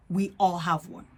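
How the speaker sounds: ordinary talk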